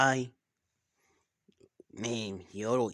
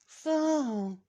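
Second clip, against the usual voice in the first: high squeaky voice; 6 dB louder than usual. Pretend. Voicing a character's high line.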